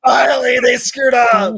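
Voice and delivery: loud, high mock yell as Dad; imitated dad's shout. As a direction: yell it out like an exasperated dad finally vindicated. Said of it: "My dad was telling me".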